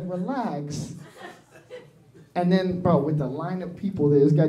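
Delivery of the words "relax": soft, low, calming word; soothing relax. Retold or imitated. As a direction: settle him down gently. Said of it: "He's like".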